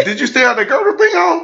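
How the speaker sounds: high questioning voice; high mock question. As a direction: ask it in a high put-on voice. Voicing someone's question.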